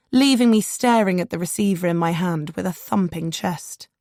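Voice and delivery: usual speaking voice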